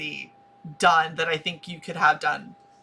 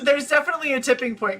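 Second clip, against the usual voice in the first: normal talking voice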